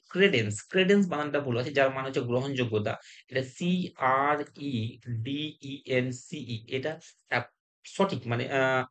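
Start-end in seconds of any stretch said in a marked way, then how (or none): 3.4-6.7 very slow, letter-by-letter spelling. Shown as a demonstration. Teacher correcting a misspelled word, spelled out slowly. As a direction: space out every letter for the class.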